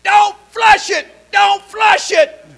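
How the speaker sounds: high, loud, slowing shouts from the pool; panicked yelling. Retold or imitated. Man in the pool yelling to nobody, hammed-up panic. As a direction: yell it in mock panic. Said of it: "he started yelling".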